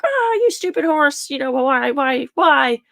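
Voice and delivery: high exasperated fake outburst at the horse; mock angry rant. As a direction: ham up an angry owner yelling at the horse. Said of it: "if I get angry".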